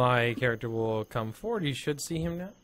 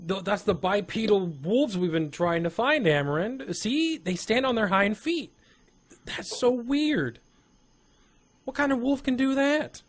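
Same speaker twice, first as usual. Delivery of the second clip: high-pitched amazed discovery; excited, wondering exclamations; 9 semitones higher than usual. Acted. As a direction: marvel at a strange find, voice lifted high. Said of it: "he's excited, very excited".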